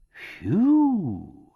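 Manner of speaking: a high, loud, excited whistle of surprise; excited exclamation. A narrator's reading. Clerk hearing the house was sold, excited "phew". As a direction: blow it out, thrilled at the news. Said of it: "exclaimed Mister McBride excitedly".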